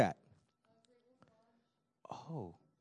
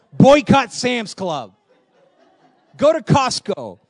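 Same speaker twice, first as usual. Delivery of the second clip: loud, high, slowed-out rallying cry; mock protest shout; 7 semitones higher, 10 dB louder, slower than usual. Preacher jokes to his church about the store refusal, mock protest shout. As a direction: shout a tongue-in-cheek boycott slogan.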